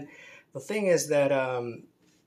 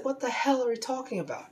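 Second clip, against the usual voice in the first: much higher, incredulous scolding; exasperated rant; 5 semitones higher than usual. Retold or imitated. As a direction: scoff at him in disbelief, pitch soaring. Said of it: "I said".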